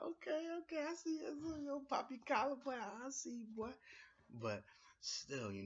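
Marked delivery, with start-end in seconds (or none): 0.0-3.7 squeaky falsetto hype; hyped reaction. Retold or imitated. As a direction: crank it way up into a falsetto. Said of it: "So I was like".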